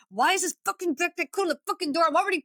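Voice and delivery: hammed-up annoyance at a stuck backpack; mock frustrated rant. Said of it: "sitting there going".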